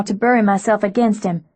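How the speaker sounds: ordinary delivery, nothing marked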